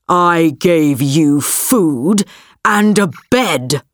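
low, slow distorted beast roar; deep distorted monster roar. A narrator's reading. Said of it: "roared the beast".

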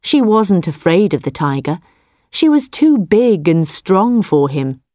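plain reading voice

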